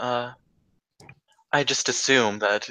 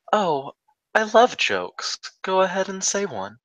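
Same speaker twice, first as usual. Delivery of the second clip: polite; 8 semitones higher than usual. Acted. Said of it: "smile very politely and say".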